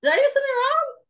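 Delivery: high, worried question; a nervous high-pitched worry; anxious inner voice. Retold or imitated. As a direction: wonder if you messed up. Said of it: "tells you".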